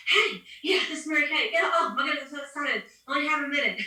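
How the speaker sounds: high, gushing pretend phone excitement; excited phone greeting. Saleswoman phoning after running the stairs, acting out of breath and excited. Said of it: "out of breath and excited".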